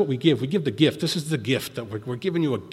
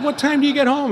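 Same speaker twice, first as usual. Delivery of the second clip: high, loud, incredulous; loud, worried demand; dismayed question; 12 semitones higher, 7 dB louder than usual. Retold or imitated. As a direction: pitch it up in alarmed disbelief. Dismayed questioning about the long trip.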